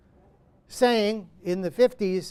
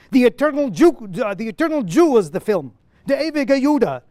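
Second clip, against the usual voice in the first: louder, impassioned play reading; Lemkin's monologue; 4 semitones higher, 7 dB louder than usual. Acted. Lemkin answering Petronius from the play, louder and impassioned. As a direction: proclaim it like a driven man with a cause.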